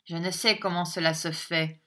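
ordinary delivery

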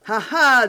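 the baron laughs ironically from the doorway; ironic laugh. Acted. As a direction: laugh at them in triumph. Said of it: "un éclat de rire ironique".

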